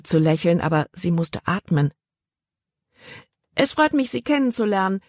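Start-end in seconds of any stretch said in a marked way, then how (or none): 3.5-5.0 quickly. A narrator's reading. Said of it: "sagte sie schnell".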